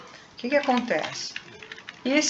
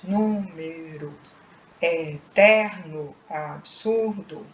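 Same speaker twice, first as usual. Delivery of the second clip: "número, eterno, absurdo" stretched into separate syllables; slow syllable-by-syllable; 2 semitones lower, much slower than usual. Shown as a demonstration. Teacher giving three-syllable example words, slowly syllable by syllable. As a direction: sound out each syllable slowly. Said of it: "quando pronunciamos lentamente".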